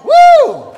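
very high loud woo; excited whoop. Real, not acted. Preacher whooping after the cadence. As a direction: let out a cheer.

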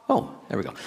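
usual speaking voice

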